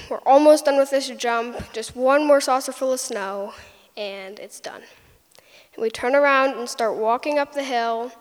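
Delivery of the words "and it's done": breathy whispered finish, pleased; hushed whisper. Retold or imitated. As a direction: whisper it like a secret.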